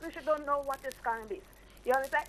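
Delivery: usual speaking voice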